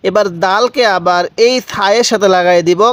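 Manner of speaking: ordinary talk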